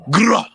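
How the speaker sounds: loud, high rolled "grrrah"; rapper ad-lib growl. Pretend. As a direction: burst out a rolled drill ad-lib. Ad-lib after claiming to be [name].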